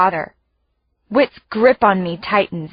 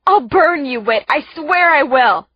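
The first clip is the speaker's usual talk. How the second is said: angry threat; 5 semitones higher, 3 dB louder than usual. A narrator's reading. Threatening her brother.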